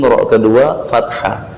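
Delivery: ordinary talk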